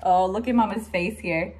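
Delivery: ordinary delivery, nothing marked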